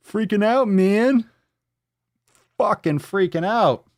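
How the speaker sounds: high, loud hammed-up panic; mock freak-out. Host freaking out over the post, high mock panic. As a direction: cry it out high, losing it.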